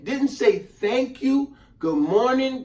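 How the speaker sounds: ordinary talk, nothing marked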